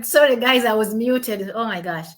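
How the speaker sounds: ordinary talk, nothing marked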